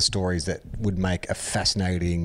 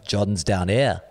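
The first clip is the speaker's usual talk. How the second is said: terrible [name] accent; slower than usual. Shown as a demonstration. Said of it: "in a terrible [name] accent".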